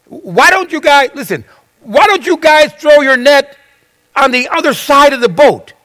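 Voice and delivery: high voice carrying across water; calling out advice. Acted. Stranger on shore advises fishermen in boat, calling out. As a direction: call instructions out to the boat.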